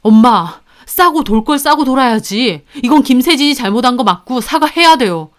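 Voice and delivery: loud, insistent protest; confronting his mother. A narrator's reading. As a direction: argue hard with your mother. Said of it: "어머님에게 따졌습니다".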